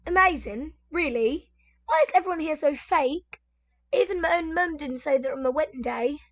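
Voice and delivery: mock the mother-in-law in a mild British accent. Imitating the mother-in-law's sneer in a British accent. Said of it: "I gotta get my British accent".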